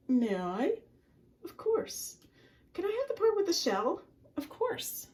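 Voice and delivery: very high, squeaky fish and crab; high squeaky exchange; high character voices. Acted. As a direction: play the polite fish.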